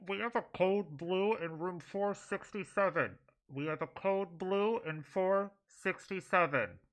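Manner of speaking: through a wall; muffled distant hospital call, loud and high; muffled, far off. Acted. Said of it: "a voice muffled off in the distance".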